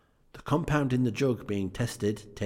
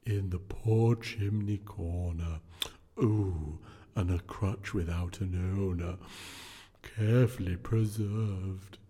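unearthly voice; slower than usual. Acted. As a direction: intone it like a solemn spirit. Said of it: "replied the ghost".

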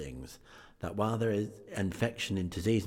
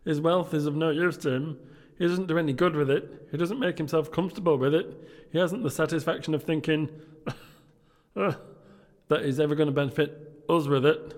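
high, loud put-on young gentleman; merry nephew; 8 semitones higher, 6 dB louder than usual. Nephew telling guests about his comical uncle, high loud voice. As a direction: pitch it high and project.

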